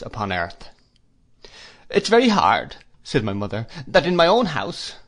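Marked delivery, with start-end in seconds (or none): 1.9-2.8 high, louder complaint with a falling end; plaintive protest. A narrator's reading. Wife objects to losing say in her own house, plaintive. 3.9-4.9 high, aggrieved wifely complaint; plaintive protest. A narrator's reading. Wife complains about her own house, plaintive.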